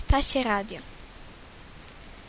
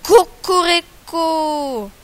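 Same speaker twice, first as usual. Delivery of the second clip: slow, loud, drawn-out crow; angry rooster crow; 4 semitones higher, 8 dB louder, much slower than usual. Acted. Rooster on the fence crowing angrily at the sparrow. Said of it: "kogut na paptyku zapiał gniewnie".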